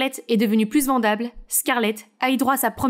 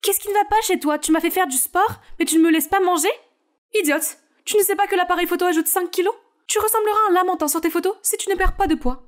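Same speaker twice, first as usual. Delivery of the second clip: strict mother scolding her daughter in a higher voice; scolding stylist mother; 6 semitones higher than usual. Acted. Mother scolding Scarlett over her diet, sharp and higher. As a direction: berate her like a demanding coach.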